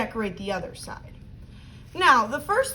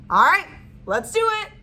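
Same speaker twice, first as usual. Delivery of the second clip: excited; 7 semitones higher, 6 dB louder than usual. Acted. Teacher rallying the kids.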